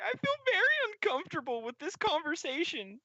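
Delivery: put-on very high, squeaky boy voice; uneasy young helper. Helper just doing his job, high uneasy whine.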